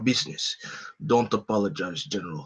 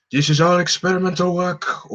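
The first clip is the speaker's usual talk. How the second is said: higher put-on Red Skull voice; Liam O'Brien's Red Skull; 7 semitones higher, 5 dB louder than usual. Player redoes the line as Red Skull, higher put-on voice. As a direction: pitch up into a villain's put-on voice. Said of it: "Liam O'Brien's, um, Red Skull impression".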